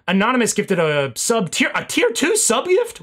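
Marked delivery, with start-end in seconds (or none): 1.7-3.0 surprised. Real, not acted. Surprised at rare gift.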